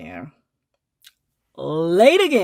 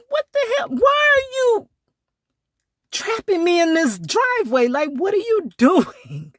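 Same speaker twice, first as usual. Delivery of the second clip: high, cracking disbelief, pitched way up; mock outraged cry; 13 semitones higher than usual. To the driver blocking her in, a high outraged squeal.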